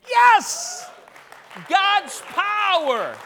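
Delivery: high, loud, stretched words; exultant preaching shout. Real, not acted. Preacher exulting over a healing, shouting in triumph. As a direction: cry it out to the whole church.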